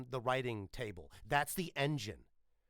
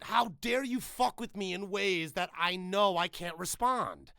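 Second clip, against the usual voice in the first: slow, high, fuming complaint; exasperated outrage; 6 semitones higher, 5 dB louder, slower than usual. Retold or imitated. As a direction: vent helpless fury at him. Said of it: "he's so explosively angry".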